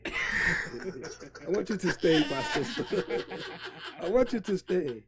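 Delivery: drawn-out laughing begging; slow laughing plea. Retold or imitated. Man begging a woman to stay, slow and laughing. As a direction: beg her to stay while laughing.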